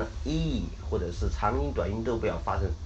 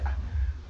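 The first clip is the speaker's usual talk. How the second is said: short breathy laugh; amused chuckle. Real, not acted. Teacher still tickled by the mistake, short chuckle. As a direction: let a little laugh escape.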